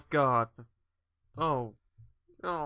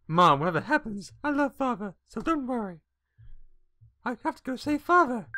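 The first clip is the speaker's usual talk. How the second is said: put-on high-pitched, earnest girlish voice; young girl, determined; 6 semitones higher, 3 dB louder than usual. Girl vowing to save her father, determined. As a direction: play a loyal daughter making a vow.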